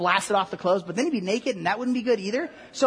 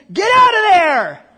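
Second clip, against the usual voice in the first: high, drawn-out full shout; yelling; 8 semitones higher, 12 dB louder, slower than usual. Acted. Some guy over here in a cemetery, bellowing. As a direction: bellow it across a graveyard, slowly.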